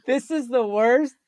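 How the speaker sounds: stretched, high cry of mock dismay at a ruined plan; mock despair. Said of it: "I'm like".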